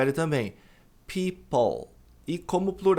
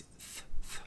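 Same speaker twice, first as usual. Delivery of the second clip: soft breathy "Th, th" with the tongue between the teeth; th sound, tongue between teeth; 8 dB quieter than usual. Shown as a demonstration. Teacher demonstrates the TH sound. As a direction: hiss out the TH sound. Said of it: "língua entre os dentes".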